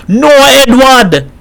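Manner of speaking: high, drawn-out cry; shouted protest. A narrator's reading. Boy being flogged begs Edward, shouted protest. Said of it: "Boy shouted".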